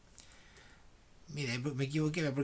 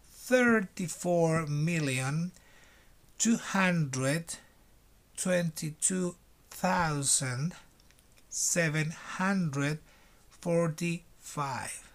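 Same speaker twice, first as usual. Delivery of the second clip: slow reading; much slower than usual. Shown as a demonstration. Teacher reading the number slowly for students to repeat. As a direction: spell it out slowly like a dictation. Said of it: "Primero lo voy a leer lento".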